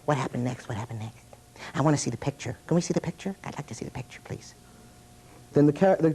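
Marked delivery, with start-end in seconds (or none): 0.0-4.5 small, soft, hushed puppet voice; quiet little puppet pleading to see; puppet character asking. Shown as a demonstration. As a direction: whisper eagerly like a curious puppet. Puppet character asking the storyteller questions.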